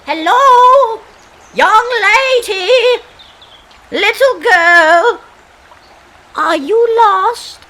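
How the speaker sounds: loud, high calling out across the water; a woman calling out loudly to a girl on the river. Acted. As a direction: hail the lost girl from the shore.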